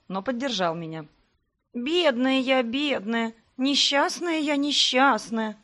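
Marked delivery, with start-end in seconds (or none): 1.7-4.6 nasty, drawn-out whine; a mock lament, pitched high and moaned; whiny voice. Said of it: "противным голосом проныла". 4.6-5.6 slow, stretched-out whine on несчастная; the lament dragged out, pitched high; whiny voice. Acted. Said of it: "противным голосом проныла".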